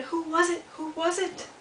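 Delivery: high, eager little-beaver voice; excited. A narrator's reading. Little Beaver asking who was crying, excited. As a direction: ask it high and eagerly, twice. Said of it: "Little Beaver is so excited".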